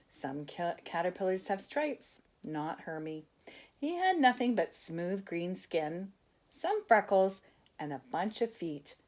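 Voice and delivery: ordinary delivery, nothing marked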